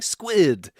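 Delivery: lightly voiced crank begging a squid; mock pleading to a squid. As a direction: beg the squid for wisdom, hamming it up. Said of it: "now it's gonna be like".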